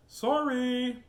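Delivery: high, stretched-out "sorry"; singsong apology. Acted. Helen to the wolf she sprayed, high singsong apology. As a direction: draw it out high, like an oops.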